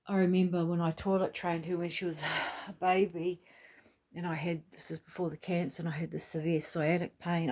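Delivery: usual speaking voice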